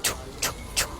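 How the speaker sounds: soft, voiceless "choo" puffs; pounding heartbeat noise. Retold or imitated. Storyteller imitates pounding heart, soft puffs. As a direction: imitate pulsing with quiet puffs. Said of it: "That shit just like".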